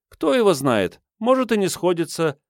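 put-on high, lively girlish voice; cheeky waif shrugging off doubts; brazen street girl. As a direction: rattle off your life story in a high pitch. Voices the street girl's life story.

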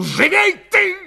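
sudden high, loud scream of command; piercing yell to hurry someone up; piercing shout. A narrator's reading. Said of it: "вдруг пронзительно крикнул".

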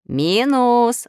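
sharp loud call of a name; shout. A narrator's reading. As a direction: call him out firmly. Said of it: "закричал Нуль". (окрик по имени)

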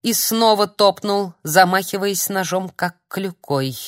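ordinary delivery

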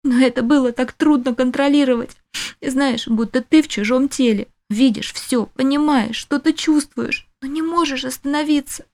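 чуть выше, растерянно; девушка, напуганная своей силой; женский голос героини. A narrator's reading. Героиня объясняет свои чувства. (голос героини=1)

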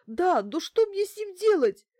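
very high, loud tearful wail. A narrator's reading. Bankina holding the lamp cord, helpless wail. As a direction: bawl it high in helpless panic. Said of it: "В трубке пошёл сплошной рёв".

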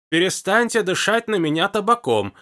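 put-on high, loud old-woman rebuke; loud complaint. A narrator's reading. Old woman loudly scolding Hugo about tobacco breath. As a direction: push it out loud and indignant. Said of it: "громко проговорила старуха".

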